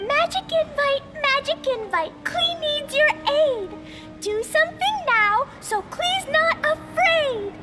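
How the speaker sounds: put-on high, chanting childlike call; shouted incantation. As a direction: call out a magic spell, drawing out the line ends. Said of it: "I just need to shout this out loud".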